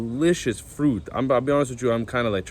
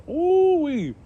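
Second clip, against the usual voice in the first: delighted ooh-wee; 5 dB louder than usual. Real, not acted. Delighted by the fruit's taste.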